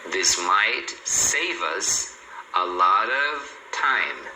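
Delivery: very slow, word groups stretched out; teacher's slow-motion pronunciation model; slow linking demo. Shown as a demonstration. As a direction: draw out "save us" and "a lot of" as joined chunks. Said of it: "Então, aqui, ó".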